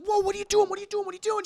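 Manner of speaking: high-pitched alarmed babble; acted frantic protest; mock panic. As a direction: blurt it over and over, high and alarmed. Bruce voiced, alarmed at the gun.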